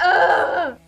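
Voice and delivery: groan, then protest loudly; indignant protest. Retold or imitated. Protesting friend's boyfriend tease.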